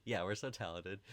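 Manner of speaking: normal talking voice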